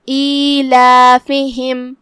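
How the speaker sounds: ایلافہم with both long vowels held two counts, kasra on fa and ha; ایلافہم with even madd; equal two-count madd. Shown as a demonstration. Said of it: "ہم دونوں مد کو برابر سے ادا کرتے ہیں".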